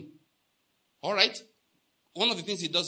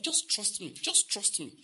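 insistent, higher-pitched repeated appeal; emphatic; 4 semitones higher, slower than usual. Retold or imitated. Preacher voicing God urging believers to trust, emphatic. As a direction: drive each repetition home. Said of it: "He's so emphatic about".

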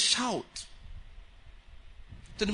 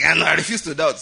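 louder mock refusal; mock defiance; 2 semitones lower, 5 dB louder than usual. Preacher mimicking a believer refusing to doubt, mock defiance. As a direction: put on a show of resolute refusal.

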